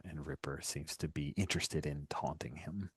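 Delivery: usual speaking voice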